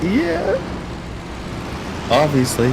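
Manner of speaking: high, sarcastic "yeah, obviously". Retold or imitated. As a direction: roll your eyes at the obvious. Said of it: "it's just like".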